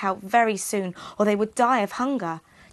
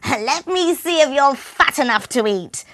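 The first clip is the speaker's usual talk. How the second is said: higher, louder cackled witch voice; cackling witch; 6 semitones higher, 7 dB louder than usual. Acted. The witch checks Hansel's finger, loud high cackle. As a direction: cackle greedily at the caged boy. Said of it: "she would cackle".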